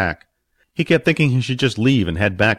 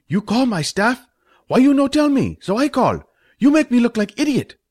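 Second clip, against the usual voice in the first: put-on much higher, louder indignant accented voice; angry Fat, high put-on voice; 14 semitones higher, 4 dB louder than usual. Restaurant owner scolding Stump, high indignant voice. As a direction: spit out the outrage in a high, pinched voice. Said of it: "looked angrily at Stump".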